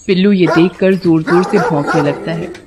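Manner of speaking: usual speaking voice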